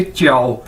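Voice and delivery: च्याओ with J said as "ch", falling; model pronunciation. Shown as a demonstration. Teacher showing learners how to say the Chinese word, model pronunciation. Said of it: "इसको कैसे बोलेंगे?".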